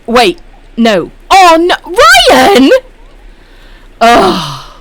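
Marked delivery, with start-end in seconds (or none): 1.3-4.7 loud, high mock protest at Ryan; mock outrage. Streamer pretends to be furious at a chatter, mock outrage. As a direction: fake indignation, all in fun. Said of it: "I'm only messing with you".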